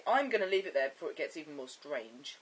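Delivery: ordinary delivery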